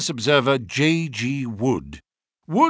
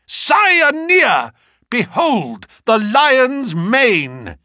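very high, loud, ringing proclamation; triumphant shout; 9 semitones higher, 5 dB louder, much slower than usual. A narrator's reading. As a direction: cry out the great discovery, drawing out the last words. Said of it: "burst into a shout of triumph".

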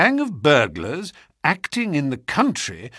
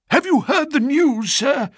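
high, urgent servant's voice, gasping out the news; a shaken butler bursting in; breathless butler; 14 semitones higher than usual. A narrator's reading. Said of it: "he gasped".

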